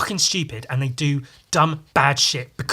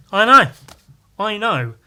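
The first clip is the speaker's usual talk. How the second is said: higher, louder burst of disbelief; excited exclaim; 8 semitones higher, 10 dB louder than usual. Real, not acted. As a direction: shout it like it's unbelievable. Exclaiming at own fact.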